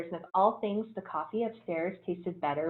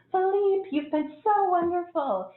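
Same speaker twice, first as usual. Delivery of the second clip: put-on high, slow doting old lady voice, lightly done; gushing older lady; 12 semitones higher, 5 dB louder, slower than usual. Older lady praising the waiter, high slow gushing voice. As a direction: coo it like a fond elderly passenger.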